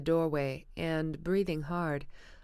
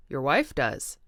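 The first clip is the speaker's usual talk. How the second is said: startled exclamation; 3 semitones lower, 5 dB louder than usual. A narrator's reading. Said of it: "exclaimed Tom, startled".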